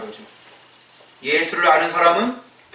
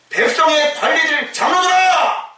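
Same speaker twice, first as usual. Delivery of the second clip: higher, slow proclaiming shout; Peter's bold shout; 9 semitones higher than usual. Retold or imitated. Preacher voices Peter addressing the rulers, shouting. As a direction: call out the elders boldly. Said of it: "이렇게 얘기를 해요".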